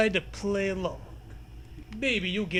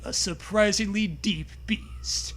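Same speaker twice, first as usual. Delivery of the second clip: usual speaking voice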